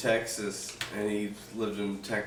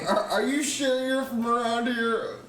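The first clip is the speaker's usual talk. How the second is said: mock questioner; 11 semitones higher than usual. Mock replay of the customer's question.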